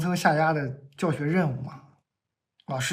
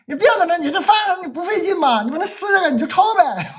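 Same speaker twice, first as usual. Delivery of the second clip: mom's voice, much higher and louder; egging the kid on like an impatient mother; imitating mom; 11 semitones higher, 5 dB louder than usual. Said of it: "我妈在后面".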